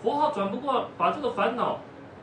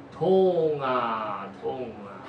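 pained moan fading away; repeated moan; 4 semitones lower, much slower than usual. Acted. Injured monk answers the third visitor, fading moan. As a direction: sigh out the pain and let it die.

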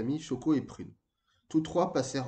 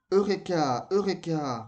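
higher, louder repeated exclamation; triumphant cry; 5 semitones higher, 5 dB louder, slower than usual. A narrator's reading. Moka repeats his discovery to his friends, triumphant cry. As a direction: proclaim the discovery with ringing excitement. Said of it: "répéta Moka".

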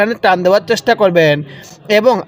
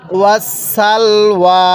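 normal talking voice

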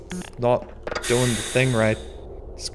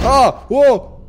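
startled yelp; 11 semitones higher, 9 dB louder than usual. Real, not acted. Another scare.

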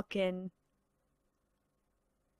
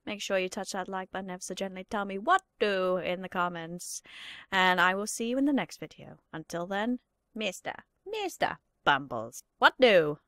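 low, much softer outro; YouTuber outro impression; 5 semitones lower, 5 dB quieter than usual. Host signing off to viewers, low soft outro. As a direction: coo the sign-off gently. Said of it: "some YouTubers".

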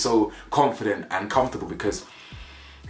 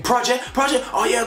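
high, sarcastic mock repeat; sarcastic girlfriend; 13 semitones higher than usual. Offended girlfriend repeating his word sarcastically, high mock voice. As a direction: throw the word back scornfully. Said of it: "she's like".

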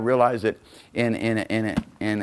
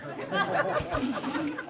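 high giggling laugh, softer; high burst of laughter; 9 dB quieter than usual. Real, not acted. Laughing at the memory.

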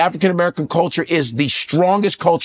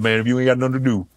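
lightly voiced Southern grandma, lower; grandma's voice; 6 semitones lower, faster than usual. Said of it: "Like my grandma said".

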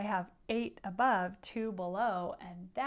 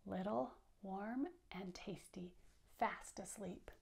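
soft whispered words; whispered narration; 8 dB quieter than usual. A narrator's reading. Teacher reading to kids, whispering about sleeping mice. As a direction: whisper it to keep them sleeping. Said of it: "reading story to children".

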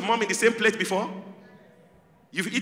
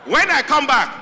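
loud, higher Nigerian mother giving orders; loud Nigerian mom; 8 semitones higher, 7 dB louder than usual. Retold or imitated. Comedian imitating a Nigerian mother ordering her daughter, loud and high. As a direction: bark chores at the child like a strict Nigerian mom. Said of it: "this is how we were trained in Nigeria".

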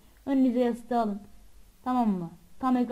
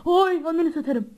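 higher, louder startled cry; frightened yell; 7 semitones higher, 6 dB louder than usual. Real, not acted. Gamer yelling at a jump scare. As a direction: yelp it out in fright.

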